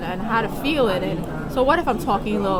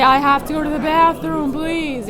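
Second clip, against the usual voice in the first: high, loud, urgent begging to be let out; patient in restraints begging staff, desperate and loud; desperate plea; 6 semitones higher, 6 dB louder than usual. Retold or imitated. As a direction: plead to use the bathroom, voice straining.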